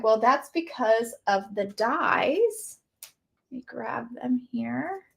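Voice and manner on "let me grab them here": soft unvoiced aside while reaching for something; whispered aside to the camera. Real, not acted. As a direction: breathe it out under your breath. Aside while grabbing the dies.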